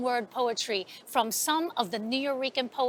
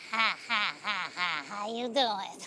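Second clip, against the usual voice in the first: low, quieter voice of an odd neighbour, laughing greeting; crazy Miss Stark; 7 semitones lower, 5 dB quieter than usual. Acted. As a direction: play the strange old lady cackling hello.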